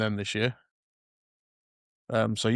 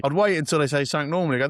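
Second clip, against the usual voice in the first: ordinary delivery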